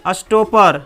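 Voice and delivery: अस्टोपर as "as-TOH-pur"; correct pronunciation. Shown as a demonstration. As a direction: say अस्टोपर with schwa before r. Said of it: "अस्टोपेर नहीं होगा".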